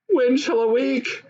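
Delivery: a mild anguished plea, as if lost in the dark; in agony. A narrator's reading. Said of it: "she said to herself in agony".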